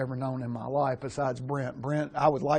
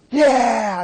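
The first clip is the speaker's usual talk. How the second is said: triumphant whoop; 8 semitones higher, 9 dB louder than usual. Retold or imitated. Victory whoop after the sprint.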